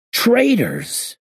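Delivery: sudden high startled cry; surprised. Acted. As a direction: blurt it out in shock.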